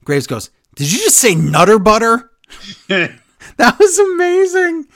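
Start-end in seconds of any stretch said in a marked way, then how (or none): 0.8-2.3 surprised. Retold or imitated. Retold commentator's amused reply. 2.9-4.9 high, slow, squealing delight; overjoyed slow squeal. Real, not acted. Delighted reaction to the quote.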